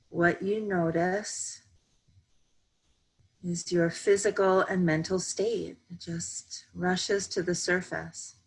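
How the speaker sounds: slow, even meditation voice with long pauses; calm, measured yoga nidra guidance; guided meditation. Real, not acted. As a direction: guide them gently into stillness, unhurried.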